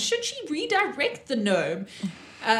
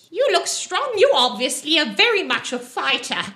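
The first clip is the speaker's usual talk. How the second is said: high, lilting challenge in a gnome voice; brash gnome woman; 6 semitones higher, 5 dB louder than usual. Acted. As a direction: play a pushy little gnome recruiting fighters, pitch swooping up.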